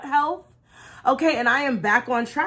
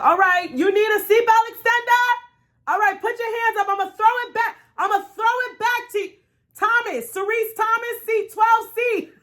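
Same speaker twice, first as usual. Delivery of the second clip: flight attendant; 10 semitones higher than usual. Retold or imitated. Woman on a plane mimics attendant announcing her seat. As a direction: ham up the brisk attendant calling her out.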